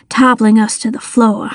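plain reading voice

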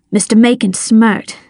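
ordinary delivery, nothing marked

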